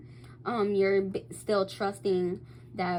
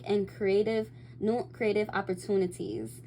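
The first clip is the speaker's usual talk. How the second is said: ordinary talk, nothing marked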